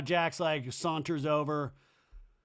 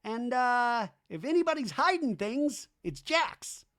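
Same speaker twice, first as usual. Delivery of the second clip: much higher aggrieved buddy voice; Danny impression; 11 semitones higher than usual. Danny confronting Jax about parties, high aggrieved voice. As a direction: whine it high like a hurt friend left out. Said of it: "Danny in a talking head goes".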